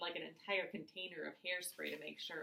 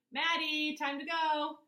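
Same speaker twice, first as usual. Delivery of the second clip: loud, higher call from the yard; 10 semitones higher, 8 dB louder, slower than usual. A narrator's reading. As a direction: call her from the front yard. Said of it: "Dad calls out to me".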